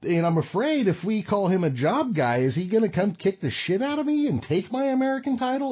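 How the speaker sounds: high-pitched, put-on worried questioning; mock dread. Champion fearing a job guy takes his title, mock alarm. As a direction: plead in comic alarm, voice climbing high.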